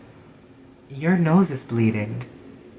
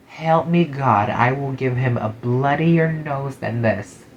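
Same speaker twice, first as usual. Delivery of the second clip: exasperated threat; slower than usual. Acted.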